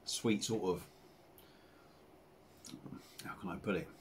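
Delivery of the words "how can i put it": usual speaking voice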